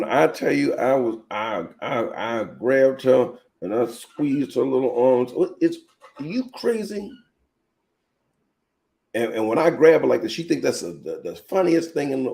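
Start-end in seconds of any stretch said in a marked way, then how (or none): 5.3-7.1 high incredulous scolding; mother scolding, much higher. Retold or imitated. Scolding the child, retold.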